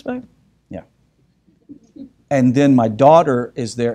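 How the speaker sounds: ordinary talk, nothing marked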